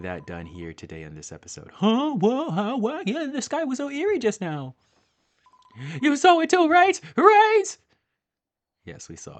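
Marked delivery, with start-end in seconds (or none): 1.7-4.8 high, surprised little fairy voice; fairy companion voice. Acted. 6.0-7.6 very high, loud, pushing for an answer; insistent fairy. Acted.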